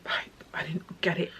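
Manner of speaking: breaking into a whisper through real grief; real tearful whisper. Real, not acted. Telling viewers about her sister's death, in a tearful whisper. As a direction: let the words go breathless with real tears.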